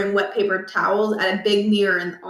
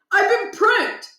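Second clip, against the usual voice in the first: high angry yell; furious teen shout; 12 semitones higher, slower than usual. Acted. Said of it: "Josh shouted".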